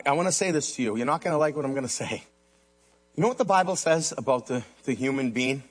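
ordinary talk

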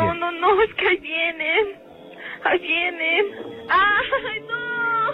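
high, crying panic as they close in; terrified crying. Acted. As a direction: sob out that they're coming. Caller panics as shadows arrive. (llanto aterrado y agudo)

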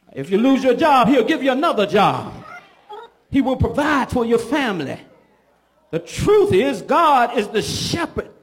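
normal talking voice

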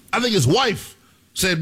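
ordinary delivery

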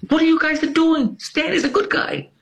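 an outraged coworker sticking up for a friend; high protesting voice. Retold or imitated. Irish guy defending Stan.

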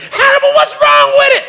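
high mock girl shriek; screaming girls. Comic quoting girls shrieking at him in hotel. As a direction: squeal it like an angry girl. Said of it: "horny girls screaming at me".

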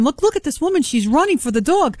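high, eager mom calling out; excited pointing out. Retold or imitated. Mom on the highway spotting a running woman, excited. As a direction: point her out, all worked up.

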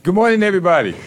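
high, loud hello to everybody; calling out a greeting. Real, not acted. Host welcoming everybody tuned in, calling out. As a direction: belt out a big morning welcome. Hosts call out show greeting.

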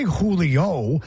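"Julio" slowly, the J sounded like an H; who sound for J. Shown as a demonstration. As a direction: say "Julio" as HOO-lee-oh. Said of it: "They know the who sound".

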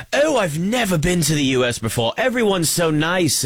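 light mock British tourist voice; a cheery British visitor, gently imitated; British accent. Vlogger mimicking a tourist's video, in a light British accent. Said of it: "I'm just gonna do a British accent".

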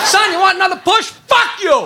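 high, loud mock-parent voice; shouting parent. Parent at the swing to a son, shouting. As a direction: call out high, then snap back.

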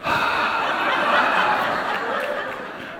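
long, airy sigh; big sigh of impatience; exasperated sigh. Retold or imitated. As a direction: let out a drawn-out weary exhale. Having a hard time waiting.

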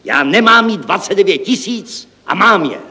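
high-pitched exasperated outcry; exclaiming. Acted. Director laments the unspent money, exclaiming. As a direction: protest it emphatically, pitch up. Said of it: "zvolal podnikový ředitel".

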